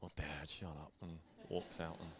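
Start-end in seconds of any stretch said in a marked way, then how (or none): 0.0-0.9 put-on lower, softer mumbled teen brush-off; lightly voiced embarrassed teenage son; a hint of a sulky son waving off his dad. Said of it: "it was almost like one of these".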